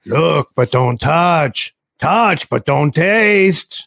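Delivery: slow, teasing film-quote impression; Al Pacino. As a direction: stretch each phrase slowly and theatrically. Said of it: "The, the, the Pacino".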